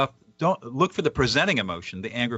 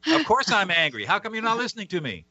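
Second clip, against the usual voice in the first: higher, exasperated comeback in mock annoyance; mock irritated retort; 8 semitones higher than usual. Speaker voices a person made angrier by questions, mock exasperated. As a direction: toss it back like a fed-up person being asked.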